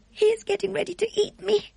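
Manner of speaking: put-on high, small scared girl voice; a young girl whispering her fears; frightened little girl. Sophie speaks to herself.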